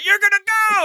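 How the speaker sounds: high, loud mock-angry dad bellow; hammed-up Hulk-style shout; mock furious yell. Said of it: "goes full fucking Hulk".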